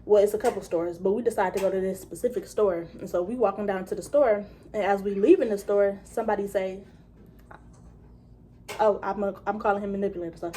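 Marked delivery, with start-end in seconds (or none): none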